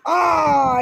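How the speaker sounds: long loud high drawn-out cry; stretched-out mock wail; imitated pain yell. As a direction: mimic the kid's yell of pain. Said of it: "ऐसे चिल्ला रहा था".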